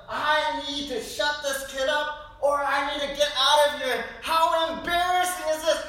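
high, loud mock panic; frazzled parent thinking out loud; embarrassed mom in the cafe, hammed up; flustered mother. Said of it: "the overwhelming sense of".

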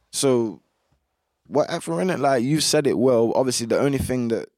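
ordinary talk, nothing marked

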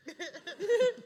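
loud burst of laughter; big laugh. Real, not acted. Opening laugh at own joke.

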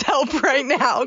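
high-pitched, laughing through the retold plea. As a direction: mock-desperate plea breaking into laughter. Laughs while retelling desperate prayer.

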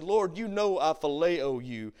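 slow, subdued confession; humbled. Retold or imitated. Peter humbled, answering the Lord, subdued and humble. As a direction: admit it humbly, taking your time. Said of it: "he was humbled greatly".

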